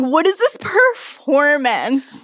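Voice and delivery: slow, drawn-out scoff; mock the showy lover, slowly; slow incredulous scoff; mocking disbelief. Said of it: "I'm like".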